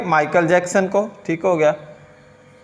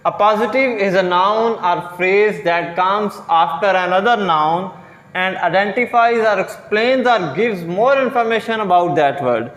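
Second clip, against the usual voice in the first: slow definition read; much slower than usual. Shown as a demonstration. Reads definition very slowly.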